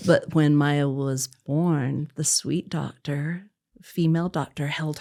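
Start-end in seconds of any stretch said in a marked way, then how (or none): none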